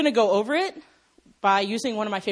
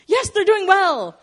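high, slow mock delight; mock cheer; 10 semitones higher, 8 dB louder, slower than usual. Reader of the Old Testament, cheering, delighted. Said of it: "So you're like".

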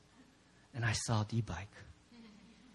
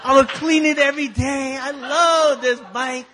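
gushing devotion; 5 semitones higher, 9 dB louder than usual. Acted. Preacher recalls doting on his bike, loudly gushing. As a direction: stretch it out in hammed-up adoration.

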